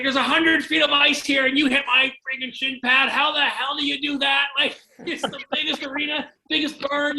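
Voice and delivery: high, hammed-up exasperated coach rant; Mav's rant. Imitating coach berating player who hit his shin, high incredulous rant. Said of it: "your impersonation of Mavney".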